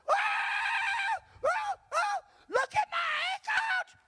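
shocked shout. Retold or imitated. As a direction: cry out in shock at your ankles. Woman jumps up, healed ankles.

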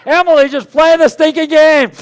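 loud, high exasperated shout; exasperated yell. Retold or imitated. Coach-dad yelling at apologetic Emily: loud exasperation. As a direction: yell from the sideline. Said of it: "I'm like".

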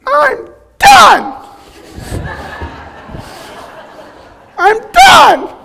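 put-on very high, loud whiny toddler wail; toddler meltdown. Father imitating his daughter's meltdown at the truck event, high loud toddler wail. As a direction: wail it like a worn-out two-year-old. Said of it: "lady just goes".

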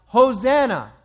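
a festive crowd hailing its king, loud; joyful shout. Retold or imitated. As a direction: proclaim it like a cheering crowd. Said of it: "those who followed were shouting".

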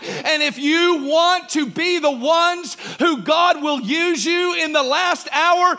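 loud, slow, fervent sermon voice; preaching at full force. Acted. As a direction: lift the room with the joy of the Lord.

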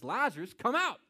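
a booming, high-pitched summons into the tomb; loud command. Acted. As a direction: call out loud and high so no one could miss it. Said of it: "in a loud voice".

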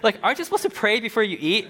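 high, quick mock scold; mock nagging voice. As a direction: poke at him like a nagging friend. Mimicking someone calling him out.

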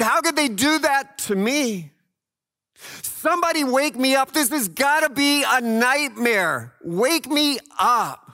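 high, loud anguished scream; desperate scream. Acted. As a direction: scream it like a waking nightmare. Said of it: "They screamed".

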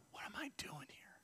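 near-silent whisper; hushed question. Acted. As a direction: whisper it as a private, searching question. God's question put very softly.

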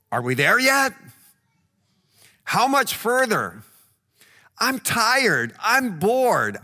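put-on child complaining to parents on a road trip, whiny. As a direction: play the bored kid nagging parents. Children whining on car trip.

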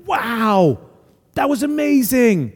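an awed, delighted wow; impressed amazement. Acted. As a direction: react as if truly impressed. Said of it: "Sometimes he's amazed, like".

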